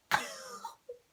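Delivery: big high burst of laughter. Real, not acted. Laughing at being grabbed by the arm. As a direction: crack up laughing at the memory.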